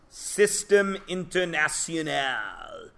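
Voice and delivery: slow, drawn-out French pronunciation; French accent. Shown as a demonstration. Teacher to class, mock French accent. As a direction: say it the French way, slowly. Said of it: "why am I saying that in that crazy accent".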